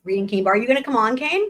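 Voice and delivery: usual speaking voice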